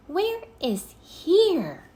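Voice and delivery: put-on a little girl wondering; Annie voice. A narrator's reading.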